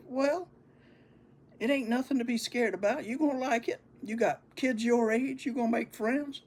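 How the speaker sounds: high, bright-pitched reassuring brother voice; older brother cheering up his scared sibling; comforting big brother. Retold or imitated. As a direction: sell it to him like it's easy.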